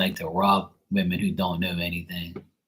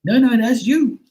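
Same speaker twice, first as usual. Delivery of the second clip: high squeaky mock protest; mock denial; 6 dB louder than usual. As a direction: mimic her shocked denial, pitched way up. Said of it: "And you're gonna say".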